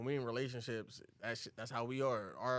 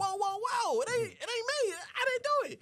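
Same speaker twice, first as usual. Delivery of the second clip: very high, louder backpedalling; mock defensive protest; 5 dB louder than usual. Mike tells the angry bride it wasn't him, high defensive protest. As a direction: protest innocence in a squeaky-high voice.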